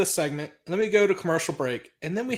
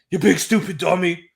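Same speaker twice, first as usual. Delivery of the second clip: lightly voiced Bret, a bit louder; mock Bret insult; 5 dB louder than usual. Recapping the show, imitating Bret taunting Sid.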